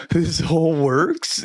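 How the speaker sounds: slow, high, laughing through the words; laughing realization. Real, not acted. Marveling to a friend about growing food, slow laughing wonder. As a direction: laugh in amazed disbelief.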